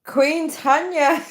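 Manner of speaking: high, slow royal announcement; mock-regal fanfare for yourself; grand proclamation. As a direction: crown yourself in a grand, drawn-out voice. Grandly crowning herself.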